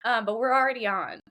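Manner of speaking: mock nervous, pitched well up; nervous kids. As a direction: imitate kids having second thoughts. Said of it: "are looking a little nervous".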